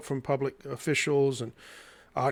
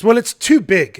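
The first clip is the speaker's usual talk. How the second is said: loud, higher-pitched mock objection; objecting; 7 semitones higher, 10 dB louder than usual. Gallery director objects to the artist's painting, loud objection. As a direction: imitate her objecting, loud and emphatic. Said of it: "she begins to object".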